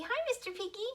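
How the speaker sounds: high, bright hello to the puppet; sing-song hello for the kids; cheery greeting. Acted.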